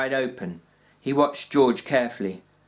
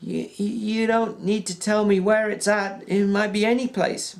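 put-on hesitant old Candy; 10 semitones higher than usual. Old Candy asking George about the place, halting. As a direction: ask haltingly, like a nervous old man.

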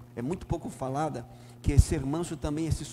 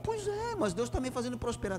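much higher, lightly mocking voice of a complacent church member; mimicking the counselee; 10 semitones higher than usual. Pastor voicing the man he counselled, high mocking imitation. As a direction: play the smug sinner, pitched way up. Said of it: "E eu disse".

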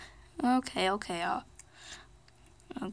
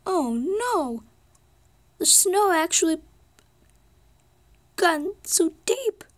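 much higher and louder, slow wailing dismay; alarmed cries; 7 semitones higher, 6 dB louder, slower than usual. Pretend. Toy car alarmed at deep snow.

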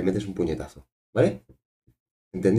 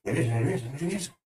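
higher, jittery nervous babbling; mock nervous jitters; 5 semitones higher, 3 dB quieter, faster than usual. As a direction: repeat it like you're having the jitters. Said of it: "tengo como un telele".

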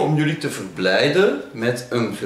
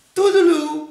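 very high, playful farewell; high sing-song goodbye; 4 dB louder than usual. Acted. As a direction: trill a high-pitched toodle-oo. Playful sign-off to viewers.

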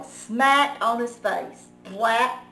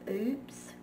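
much softer sheepish "Oops"; embarrassed; 9 dB quieter than usual. Acted. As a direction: shrink into it. Caught, embarrassed.

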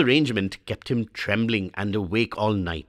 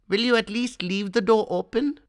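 put-on boy's plea, much higher; Swami pleading; 11 semitones higher than usual. A narrator's reading. Frightened boy begging father to let him sleep in the hall, much higher. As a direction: beg like a scared little boy.

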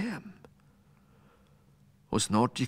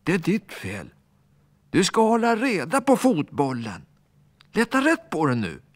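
put-on higher, sharp scolding child; 8 semitones higher, 3 dB louder than usual. Alfons blaming the ball boy, higher and scolding. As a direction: tell him off hard. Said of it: "Alfons grälade på lillkillen".

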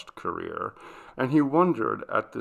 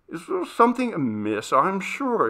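higher-pitched, mildly probing question; concerned inquiry; 9 semitones higher than usual. Acted. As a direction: ask with a touch of concern. Master questions troubled servant girl.

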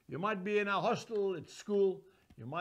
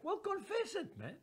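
answer to Angus; 10 semitones higher, 7 dB quieter than usual. Acted.

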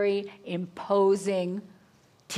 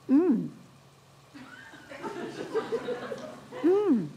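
a rising hum of pleasure, the second one higher; imitating the teacher's hum of delight over the soup; 9 semitones higher than usual. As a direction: savour it with an involuntary mm of delight. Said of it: "involuntary spasm of vocal delight".

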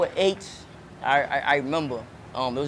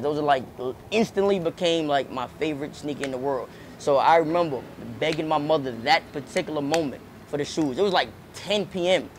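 normal talking voice

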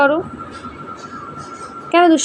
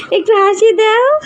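higher, slower, whiny begging for a smile; high pleading coax; 5 semitones higher, slower than usual. Acted. Father begging his little one for a smile, whiny and high. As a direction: plead sweetly like a pouting child.

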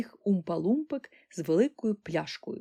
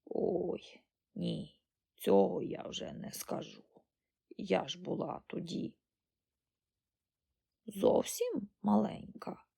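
put-on hushed, feeble old woman; frail old granny; 5 dB quieter, slower than usual. A narrator's reading. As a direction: answer faintly, almost without voice. Ancient granny struggles to remember.

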